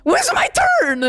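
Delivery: mock complaint. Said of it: "I'm like".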